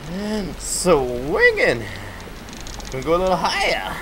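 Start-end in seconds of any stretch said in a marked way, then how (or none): 0.1-1.8 slow drawn-out sing-song. Pretend. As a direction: stretch the word out slowly.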